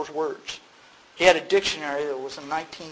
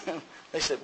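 normal talking voice

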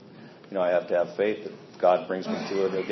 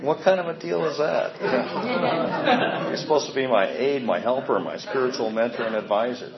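higher and louder, laughing disbelief at first; mock indignant protest; 7 semitones higher, 5 dB louder than usual. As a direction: play up the outrage at the sponsor, half laughing. Retold protest to his sponsor.

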